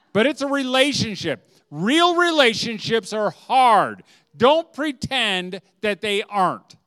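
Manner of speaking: high, loud, slow insistence; emphatic preaching. Real, not acted. Preacher insists real relationships are hard, emphatic declaration.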